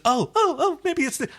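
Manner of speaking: high, hammed-up dithering Zhaan voice; mock Zhaan. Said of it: "Zhaan is just".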